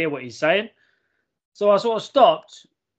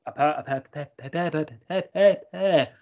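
light stuttering cartoon boy, stuck on the same syllable; Jimmy from South Park. Telling friends about his outfit, a lightly voiced stuttering cartoon boy. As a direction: stammer over the word like a cartoon kid. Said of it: "I went Jimmy from South Park then".

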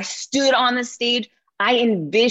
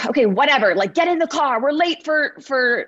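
higher, brushed-off mom voice; dismissive hurry; 6 semitones higher than usual. Retold or imitated. Mom brushing off her son's idea before school, impatient. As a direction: wave it off and hustle him out.

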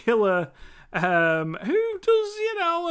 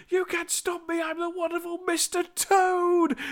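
higher-pitched boastful toad voice; Mister Toad; 9 semitones higher than usual. Retold or imitated. The driving toad boasting to the reviewer's viewers, higher boastful voice. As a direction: lift the pitch and crow like a vain toad driver. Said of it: "Mister Toad is like".

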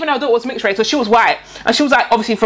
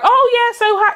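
higher-pitched chatty imitation; mock small talk; 9 semitones higher than usual. Imitating British people filling the silence, mock-chatty. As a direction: put on a polite, high small-talk voice.

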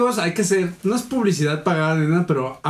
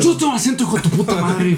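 mock outrage; 2 semitones higher than usual. Friend denies being told of an accent, mock outrage. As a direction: fire back in pretend fury.

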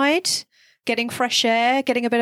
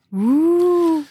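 ghost woo; 6 semitones higher, 4 dB louder than usual. Shown as a demonstration. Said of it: "Oh, so my".

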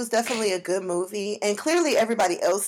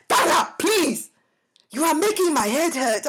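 imitated exasperated boyfriend; 7 semitones higher than usual. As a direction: play the fed-up African boyfriend mid-argument. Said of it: "He's like".

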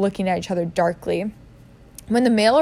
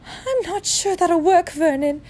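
aunt voice; 9 semitones higher, slower than usual. A narrator's reading.

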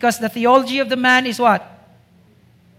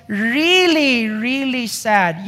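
drawn out slowly; much slower than usual. Real, not acted. Preacher drags out the sadness.

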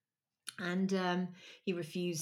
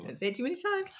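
usual speaking voice

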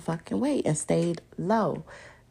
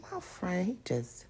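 whisper; 8 dB quieter than usual. Retold or imitated. Whispered quote.

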